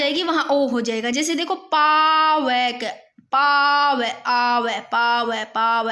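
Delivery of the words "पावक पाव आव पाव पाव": "पावक" slow with a long aav; sounded out. Shown as a demonstration. Teacher showing students a sound, "पावक" broken down slowly. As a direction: hold the aa in "पावक", paa-vak. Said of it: "आव की आवाज आ रही है".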